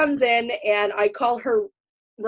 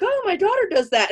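proud mom; 12 semitones higher than usual. Retold or imitated. Proud mom telling coworkers.

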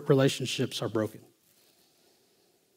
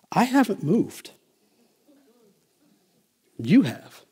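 husband's pointed reply; 7 semitones higher, slower than usual. Acted. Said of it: "driving, he goes".

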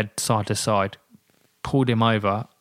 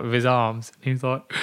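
ordinary delivery

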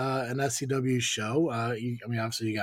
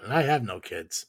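ordinary talk

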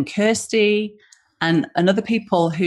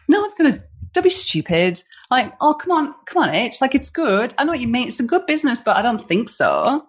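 higher, mocking brush-off of a silly idea; dismissive friend; 7 semitones higher than usual. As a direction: imitate a friend talking you out of it, a bit sing-song. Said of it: "And if she'd have gone".

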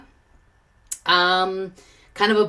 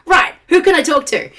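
louder, higher, mock-eager announcement; eager to talk; 8 semitones higher, 6 dB louder than usual. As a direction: play up being raring to chat. Acts out eagerness after regaining voice.

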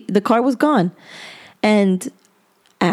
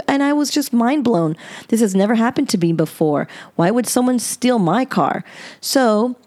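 usual speaking voice

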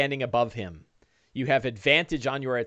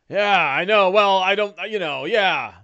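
halting, pitched-up reply; Don John; 6 semitones higher, 5 dB louder than usual. Acted. Latecomer praised by the party, higher halting voice. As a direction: fumble bashfully through a compliment. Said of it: "Don John".

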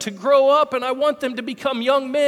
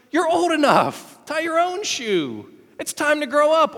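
put-on higher, firm telling-off of a kid; scolding dad; 5 semitones higher than usual. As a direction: tell him off like a fed-up dad. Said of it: "and I'm like".

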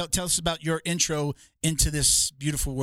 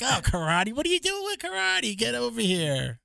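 high-pitched mock scoffing at karate; bougie jiu-jitsu snob; 9 semitones higher than usual. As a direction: play a snob in a squeaky put-on voice. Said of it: "we're so bougie".

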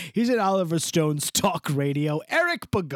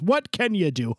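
usual speaking voice

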